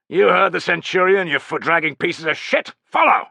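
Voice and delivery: loud, high-pitched bark at the troops; rousing military yell, rising to the last word; shouted order. A narrator's reading. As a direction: bellow it like a drill officer. Officer barking orders at his soldiers.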